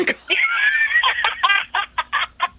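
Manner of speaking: big squealing laugh. Real, not acted. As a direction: burst of high shrieking laughter. Laughs at crazy vacation.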